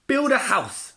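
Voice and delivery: a spoilt piglet barking an order; bossy piglet. A narrator's reading. Brenda orders Brian about, high and loud.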